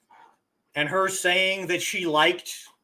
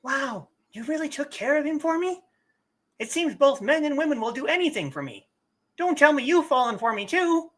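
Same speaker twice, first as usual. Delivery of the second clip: flirty high female voice; 8 semitones higher, faster than usual. Acted. Voicing flirtatious female character.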